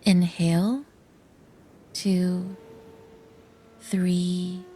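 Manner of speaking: slow, soft count with long pauses; hushed, unhurried breathing-exercise voice; guided breathing count. Shown as a demonstration. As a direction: count the breaths softly, word by spaced word. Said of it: "The breathing process goes like this".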